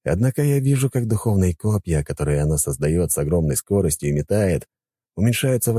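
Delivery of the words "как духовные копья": ordinary delivery, nothing marked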